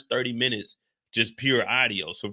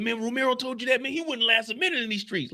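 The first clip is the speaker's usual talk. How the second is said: imitating him, pitched well up; high-pitched retold boast; 9 semitones higher than usual. Retelling what a TV character said about the streets, in a high mimicking voice.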